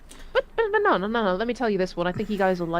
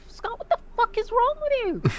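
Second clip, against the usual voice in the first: much higher, scolding; exasperated yell; 13 semitones higher than usual. Retold or imitated. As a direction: shriek at him in disbelief. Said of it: "I'm, I'm like".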